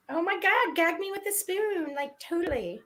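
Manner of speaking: put-on high Valley Girl drawl; high, sing-song Valley Girl. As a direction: gush it like an eighties Valley Girl. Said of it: "Valley Girl drawl".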